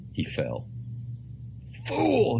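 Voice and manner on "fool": high, sharp cry; cried out. A narrator's reading. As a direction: cry it out at yourself mid-fall. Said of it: "he cried".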